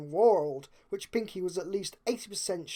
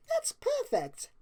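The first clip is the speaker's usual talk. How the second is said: much higher, slow and gushing; delighted Pinkie; slower than usual. A narrator's reading. Pinkie cheering the picnic plan, clapping her hooves. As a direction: stretch it out with glee. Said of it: "Pinkie said".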